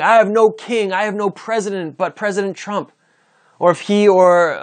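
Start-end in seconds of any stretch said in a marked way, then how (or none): 0.0-2.9 mock allegiance oath. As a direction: swear it as a mock pledge. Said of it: "It'd be like me saying".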